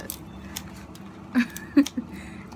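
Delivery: airy rising giggle; giggling helplessly; laughing. Real, not acted. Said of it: "I can't stop laughing".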